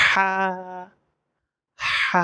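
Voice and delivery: হা with a long airy throat h, then held aa; mid-throat h. Shown as a demonstration. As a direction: breathe each হা out from mid-throat, drawn out. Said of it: "কণ্ঠনালীর মাঝখান থেকে". (কণ্ঠনালীর মাঝখান থেকে হা)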